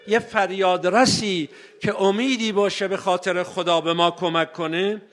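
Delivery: ordinary talk, nothing marked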